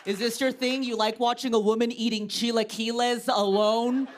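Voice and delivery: snapping at a staring stranger, playfully; mock confrontation. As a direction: taunt the gawker, chest out. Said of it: "I'm like".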